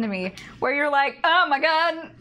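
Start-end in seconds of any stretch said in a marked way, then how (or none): 1.2-2.1 higher, louder dismayed exclamation; mock alarm. Bartender realizing the customer is drunk, alarmed. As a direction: act out a bartender's dawning horror.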